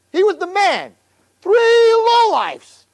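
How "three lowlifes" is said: soaring, slow, stretched-out exclamation; emphatic high cry. Real, not acted. Preacher crying out to his boys in awe. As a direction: lift the words up in wonder. Preacher builds to Avraham's greatness.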